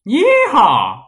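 high, loud cowboy yeehaw; mock American accent. Impersonating the American co-host on request, loud mock cowboy yell. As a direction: belt it like a cartoon cowboy. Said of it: "Give it a shot".

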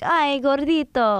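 slow, cooing "Ay, gordito"; cute gordito. Shown as a demonstration. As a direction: say it sweetly, like to a chubby baby. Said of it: "the cute way of saying it".

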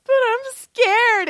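high, loud, drawn-out whine; hammed-up frightened squeal; mock scared wail. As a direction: play up being scared, stretching it out high. Retold frightened reply to advice.

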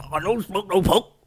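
put-on high, loud garbled local accent; mock market local. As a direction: imitate a market bloke in a hammed-up accent. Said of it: "some guy at the Christmas market".

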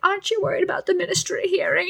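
high, quick mock-Hermione worry. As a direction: pitch up and rush the worried question. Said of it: "And Hermione's like".

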